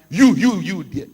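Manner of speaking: a sharp loud call picking someone out of the crowd. Retold or imitated. Pastor in the pulpit calling out a member, loud. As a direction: bark it at one person in the pews.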